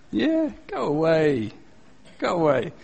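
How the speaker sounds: mock fed-up, drawn-out brush-off; weary dismissal. Class tired of the drunk heckler, imitated slow dismissal. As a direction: slowly wave off a nuisance.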